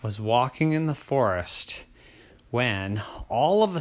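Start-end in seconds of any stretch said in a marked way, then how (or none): none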